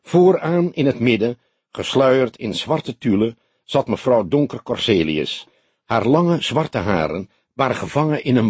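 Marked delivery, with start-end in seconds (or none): none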